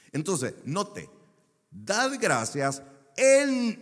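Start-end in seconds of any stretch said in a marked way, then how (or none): 1.7-3.8 "en" drawn out, high and loud; slow, rising weight on the word "en"; stress on en. Shown as a demonstration. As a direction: lean hard on "en" in "Dad gracias en". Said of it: "no dice "por".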